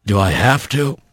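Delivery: low, feeble reluctance; dazed, low-voiced complaint; weary Bobcat. A narrator's reading.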